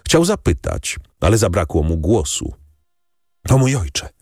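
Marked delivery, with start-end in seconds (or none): 3.5-4.1 crying out. A narrator's reading. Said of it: "zawołała dziewczyna".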